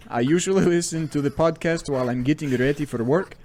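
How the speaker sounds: put-on butchered Russian accent, stretched and sing-song. Podcast host reads a listener's review, mock Russian accent. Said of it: "again, I will butcher this accent".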